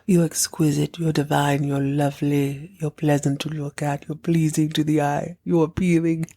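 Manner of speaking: put-on voice reeling off compliments in an even tone; mock creepy admirer. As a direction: imitate an entranced suitor listing adjectives. Imagining Peter's endless string of adjectives.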